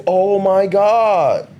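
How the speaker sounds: drawn-out mock horror; slow, exaggerated dismay; mock shock. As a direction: imitate everyone being scandalized, stretching each word. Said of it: "everybody took it as if".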